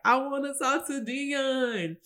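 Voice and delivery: put-on slow, high, slurred drunk plea; hammed-up drunk, drawn out and high; drunk guy. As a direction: whine it like a sloppy drunk calling for his ex. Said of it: "he was drunk and he was".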